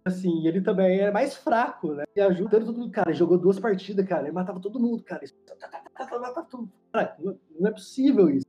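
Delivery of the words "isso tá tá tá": soft, whispered rapid "tá tá tá"; whispered tapping. Retold or imitated. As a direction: whisper the taps quickly. Imitating rapid kills while telling.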